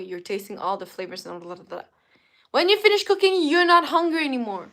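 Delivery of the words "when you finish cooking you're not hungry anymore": loud, high-pitched exasperated explaining; animated rant. Real, not acted. Streamer ranting to viewers about cooking, loud and animated. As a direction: push it out high and loud, making the point.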